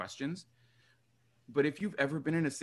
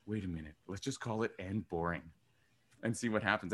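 quiet realization; 4 semitones lower, 6 dB quieter than usual. Retold or imitated. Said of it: "And I was like".

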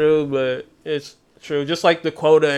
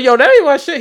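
high, loud denial; high-pitched loud mock denial; 12 semitones higher, 8 dB louder than usual. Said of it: "he's like".

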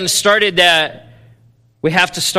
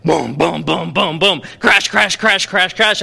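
imitated drum crashes; 2 semitones higher, 4 dB louder than usual. Preacher mimicking Christians without love as noisy crashing, loud booms. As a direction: pound it out like clumsy drumming.

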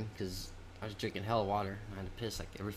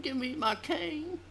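put-on high, slow croaky old man asking for his cane; slower than usual. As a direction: play a frail old geezer.

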